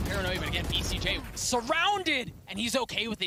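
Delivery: hyped play-by-play, pitch jumping up; excited esports caster. Real, not acted. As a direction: call the fight with rising excitement.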